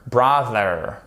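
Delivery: normal talking voice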